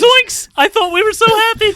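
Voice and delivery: loud high yelp into squeaky lament; cartoon shock, then mock heartbreak; very squeaky, hammed-up dismay; Shaggy. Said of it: "Do the zoinks".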